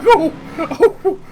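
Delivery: high, loud laughing "oh, oh"; pained yelps. Retold or imitated. Kid popped in the mouth, high laughing yelps. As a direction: yelp twice through a laugh.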